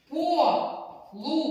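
«Полум'я» slowly, split into syllables; drawn-out «Полум'я» for dividing into syllables. Shown as a demonstration. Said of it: "Тут можна і полум'я". (повільно по складах)

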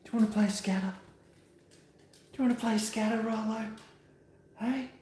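high, coaxing voice for the dog; dog-talk voice. Real, not acted.

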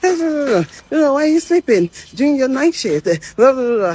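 high-pitched mock telling-off, running into babble; retold angry scolding. Manager scolding worker for sleeping on night shift, mock-angry. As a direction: imitate a manager ranting on and on. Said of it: "they shout".

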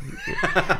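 a high burst of real laughter; big laugh. Real, not acted. Laughing after telling the tearful-Marie story. Laughing at his own punchline.

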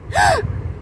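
quick gasp of alarm; sharp gasp. Acted. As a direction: suck in a sharp breath. Sudden gasp in the night.

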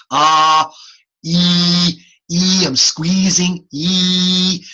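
squeezed ee sound, slow; changing mouth shape. Shown as a demonstration. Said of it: "I'm making my mouth different".

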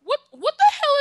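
high, loud disbelieving shout; shocked outburst. Real, not acted. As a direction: blurt it in total disbelief. Shock at destroyed server.

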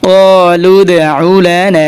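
ক্বালু দউ লানা drawn out; measured melodic recitation; slow recitation. Shown as a demonstration. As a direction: recite slowly with long vowels. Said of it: "কফ আলিফ জবর ক".

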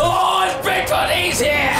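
alarmed shout. Real, not acted. Player warning the team that Bigfoot is here, shouted alarm. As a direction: call it out to warn the team. Spotting the monster, calling out.

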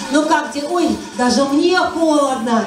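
usual speaking voice

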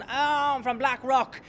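slow mock posh south Dublin accent. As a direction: drawl it like a posh Blackrock lad. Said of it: "change the accent wanker".

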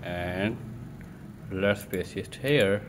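ordinary delivery

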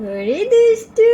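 high, loud, drawn-out teasing; playful tease. Real, not acted. Mother teasing her baby daughter, high and drawn out.

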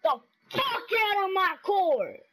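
real gamer shout; loud yell. Real, not acted. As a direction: order him off the court. Yelling at game opponent.

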